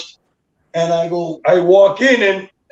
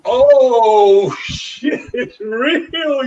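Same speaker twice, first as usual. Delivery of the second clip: higher, laughing oh shit; laughing disbelief; 5 semitones higher, much slower than usual. Retold or imitated. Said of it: "I'm like".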